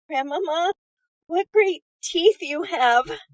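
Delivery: put-on high, slow, loud little girl; alarmed exclamation. A narrator's reading. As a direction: gasp it out, wide-eyed.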